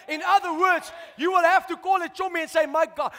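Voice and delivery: loud, driving preacher's call; full-force preaching. Acted. Preacher rousing the church about blessing, loud and fervent. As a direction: preach it hard to the congregation.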